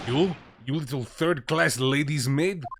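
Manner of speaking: put-on Russian-accented sailor snapping in sudden anger; angry sailor. Sailor rounding on a suspected stowaway, angry and loud. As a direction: bark it at the intruder.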